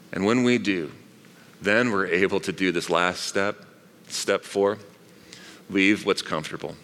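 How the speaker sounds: ordinary talk, nothing marked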